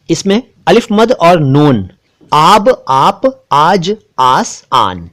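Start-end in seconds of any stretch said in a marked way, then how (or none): 2.3-5.1 आब, आप, आज, आस, आन each spoken apart with a long aa; word drill. Shown as a demonstration. Teacher drilling viewers on long-aa words. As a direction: read out one by one, slow and deliberate. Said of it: "उच्चारण पर ध्यान दीजिएगा".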